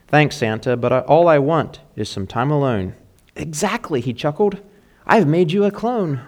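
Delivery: lively storytelling read of a rhyming poem. A narrator's reading. Pastor reads a funny poem to the mothers, playful and rhythmic. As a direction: read the holiday poem to the congregation with a bounce.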